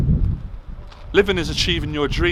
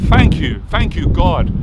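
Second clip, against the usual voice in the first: a burst of real gratitude, loud; loud thanks to God; 7 dB louder than usual. Real, not acted. Grateful speaker shouting his thanks to God, loud. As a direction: call out your thanks to God with feeling.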